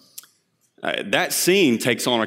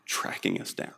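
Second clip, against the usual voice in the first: whispered; 12 dB quieter than usual. Acted. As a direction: drop to a hushed whisper. Final repetition whispered.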